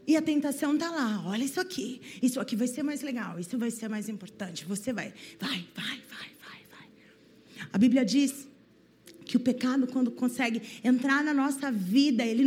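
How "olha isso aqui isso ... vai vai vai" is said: a soft tempting voice fading into a whisper; sussurro tentador. Pretend. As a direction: lure them in, fading to breath. (a tentação sussurrando, sumindo até um fio de voz)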